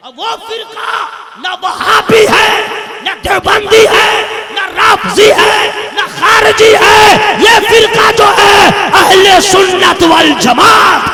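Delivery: loud high fiery preaching, shouted to the crowd; preaching at full force. Acted. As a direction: thunder it out like a jalsa orator. (जोशीली तकरीर, ऊँची आवाज़ में)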